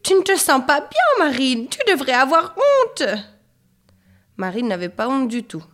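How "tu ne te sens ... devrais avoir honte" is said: high, loud motherly outrage; outraged scolding. A narrator's reading. Horrified mother shames Marine, loud high scolding. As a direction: scold her at full pitch.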